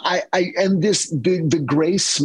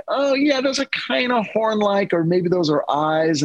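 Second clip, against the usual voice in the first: musing aloud; 7 semitones higher than usual. Retold or imitated. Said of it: "So it's like".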